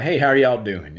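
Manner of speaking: friendly Alabama greeting; Alabama accent. Shown as a demonstration. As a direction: greet them in an Alabama twang. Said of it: "that's how I am in Alabama".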